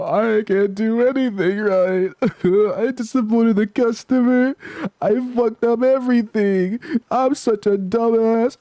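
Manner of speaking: very high, slow, whiny self-pity; despairing SpongeBob. Retold or imitated. Vlogger voicing SpongeBob's despair after failing the customer, high slow whine. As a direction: wail it slowly in a high put-on cartoon voice, half laughing.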